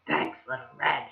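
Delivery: low, soft wolf voice; a quiet scheming thank-you; low hushed wolf; sly wolf. Acted.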